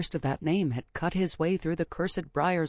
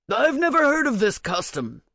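blustering king; 6 semitones higher, 6 dB louder than usual. A narrator's reading. King dismisses old custom.

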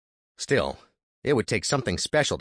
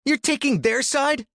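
high, louder angry spit; spat; 4 dB louder than usual. Acted. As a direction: spit the words at him. Andy angry.

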